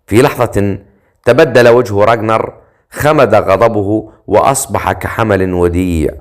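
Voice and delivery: usual speaking voice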